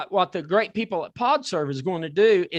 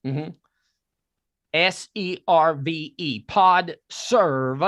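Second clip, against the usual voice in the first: very slow spelled-out; much slower than usual. Shown as a demonstration. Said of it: "if you can understand this Southern accent".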